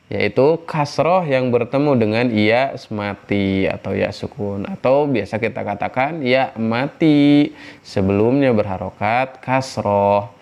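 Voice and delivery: ordinary delivery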